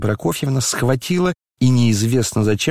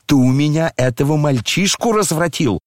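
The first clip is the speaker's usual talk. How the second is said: ordinary delivery, nothing marked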